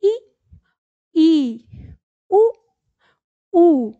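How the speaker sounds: ई, ई, उ, ऊ as isolated vowels, short then long; repeat-after-me model. Shown as a demonstration. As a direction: recite each vowel alone with a pause after it. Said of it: "एक बार मेरे साथ आप दोहरा लीजिए". (ई, ई, उ, ऊ अलग-अलग, छोटा फिर लंबा)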